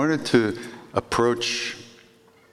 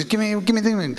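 much higher, repeated give me; insistent demand; 13 semitones higher than usual. Retold or imitated. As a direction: beg and insist at once. Maharaji demanding the pouch.

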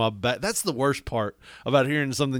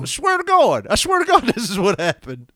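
high, loud pleading insistence; insistent; 8 semitones higher, 5 dB louder than usual. Retold or imitated. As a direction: swear it's true. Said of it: "You're like".

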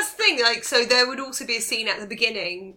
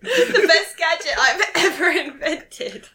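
proud Q; 4 semitones higher, 5 dB louder, slower than usual. Acted. Q presenting gadget proudly.

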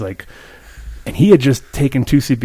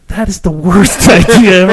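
high, emphatic retold objection; loud protest; 7 semitones higher, 9 dB louder than usual. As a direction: protest loudly, amused at the memory. Retold protest to friend's hug remark.

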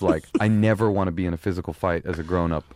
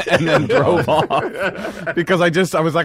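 slow words squeezed out through laughter; laughing while talking; 9 semitones higher, 5 dB louder, slower than usual. Real, not acted. Laughing while telling friends how the gangsters drove off. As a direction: let the laugh carry the words, slow and high.

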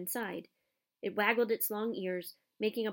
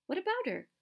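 surprised; 10 semitones higher than usual. A narrator's reading. Ron surprised.

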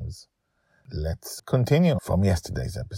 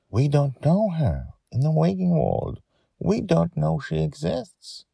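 ordinary delivery, nothing marked

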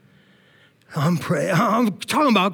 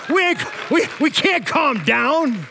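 ordinary talk, nothing marked

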